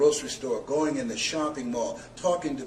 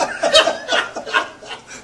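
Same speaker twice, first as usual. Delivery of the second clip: high, loud burst of laughter; real high-pitched cackling laugh; big laugh; 10 dB louder than usual. Real, not acted. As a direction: laugh hard at your own excitement. Laughing at own excitement.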